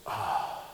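hushed, breath-only "oh"; deflated "oh". Retold or imitated. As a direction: let out a soft, deflating breath. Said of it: "everything just went".

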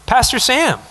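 a hint of a kid calling out formally; lightly voiced daughter.

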